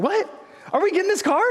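high-pitched incredulous question; bewildered kid. Retold or imitated. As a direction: play a baffled boy, voice jumping up. Boy confused at leaving the dealership.

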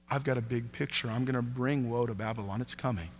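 soft, low, calm divine answer; quiet reassurance. Acted. God calmly answering the doubting prophet, soft and low.

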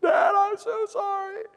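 put-on slow, very high child wail; mock crying. Daughter facing two spankings, exaggerated tearful wail. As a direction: whine it through imitated tears. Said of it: "immediate crying".

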